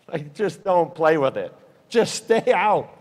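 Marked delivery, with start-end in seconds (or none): 1.9-2.9 "stay out" stretched slow and higher; drawn-out warning. Real, not acted. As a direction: urge them, lengthening "stay out". Warning repeated, words stretched.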